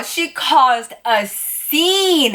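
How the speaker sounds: ordinary talk, nothing marked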